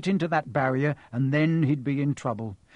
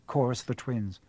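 plain reading voice

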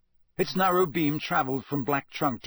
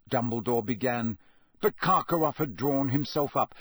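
ordinary delivery

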